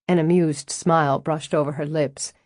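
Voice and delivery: usual speaking voice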